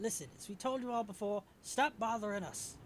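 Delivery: acted exasperated warning; fed-up sailor. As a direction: scold the pests from on board. Sailor tells players off.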